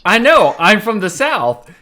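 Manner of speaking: high, loud, emphatic admission; loud exclamation. Real, not acted. Game master answering teasing about a Southern accent, loudly exclaiming.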